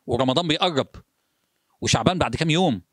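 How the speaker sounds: ordinary talk, nothing marked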